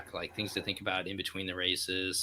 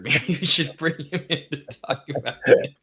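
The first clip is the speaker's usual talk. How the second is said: laughing fit; 5 semitones higher, 9 dB louder than usual. Real, not acted. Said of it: "why is this so funny?".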